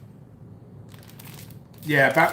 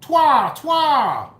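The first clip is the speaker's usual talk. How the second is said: high, loud taunting repeats of Twa; sing-song mockery of the name Twa; mocking the name; 9 semitones higher, 5 dB louder than usual. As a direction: squawk the name like a jeering kid. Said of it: "Yes, I'm making fun of his name".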